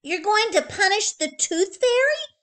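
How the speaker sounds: put-on high, loud, eager child's question; hopefully. A narrator's reading. Little girl to her mother, hopeful and high. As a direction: ask full of hope. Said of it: "Betty Bunny asked hopefully".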